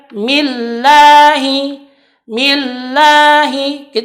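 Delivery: millahi drawn out slowly; slow recitation. Shown as a demonstration. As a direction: millahi with long i. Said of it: "kesalahan di sini ketika menyebutkan". (millahi dibaca pelan)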